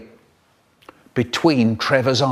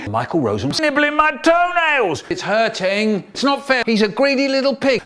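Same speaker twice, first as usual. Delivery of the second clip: high whiny shout; a high tattling wail, faster at the end; 9 semitones higher than usual. Acted. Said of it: "Richard shouting".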